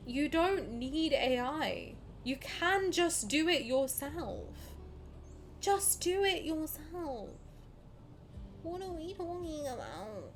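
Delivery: slow, drawn-out plea; exasperated pleading. Real, not acted. Exasperated plea against using AI.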